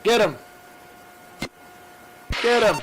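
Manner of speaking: loud cheering shout. Real, not acted. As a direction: yell it like a fan. Cheering pursuit.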